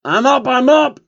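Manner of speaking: jolted awake, high and loud; groggy panic, high and loud; startled waking up. Acted. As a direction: blurt it out like you were just shaken awake.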